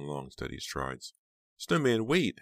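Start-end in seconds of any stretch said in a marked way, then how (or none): 1.6-2.4 high, loud call after him; shout. A narrator's reading. Heather shouting after Snowman leaving, high and loud. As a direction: call him back before he's gone. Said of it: "Heather shouted".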